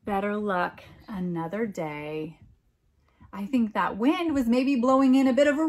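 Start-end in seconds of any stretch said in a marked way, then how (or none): none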